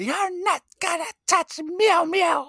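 slow squeaky falsetto; high mock character voice. Co-host repeating his favorite episode quote, slow falsetto. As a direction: drag it out high and precious.

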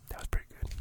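breathy whispered self-praise; whispered aside. Real, not acted. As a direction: whisper it to yourself, pleased. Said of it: "self-praise whispered".

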